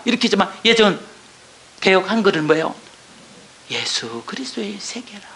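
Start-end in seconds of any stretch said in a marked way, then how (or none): 3.7-5.3 breathless whisper, barely voiced; whispered verse. Real, not acted. Testimony reader hushing the verse to the congregation, whispered. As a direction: let the verse fall to a hush. Reading the old translation, overcome.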